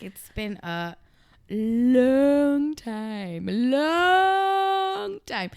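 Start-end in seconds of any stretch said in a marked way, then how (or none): 1.5-3.5 exaggerated. Stretched exaggerated greeting. 3.5-5.5 high drawn-out wail; exaggerated. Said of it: "I'm out of breath".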